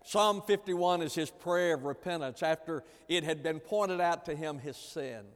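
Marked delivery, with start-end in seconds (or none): none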